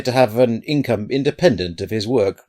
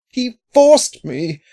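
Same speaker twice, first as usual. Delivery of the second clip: desperate protest; 8 dB louder, much slower than usual. A narrator's reading. Terrified man pleading his case.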